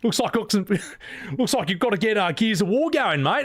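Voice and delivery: a hint of broad Australian, teasing; mock Australian accent. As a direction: send up an Aussie hero line. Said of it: "it just sounds stupid".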